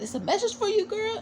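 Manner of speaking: high, playful sing-song; teasing singsong. Retold or imitated. Blanca teasing Angel about a man's phone message, high playful. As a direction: tease her friend about the call.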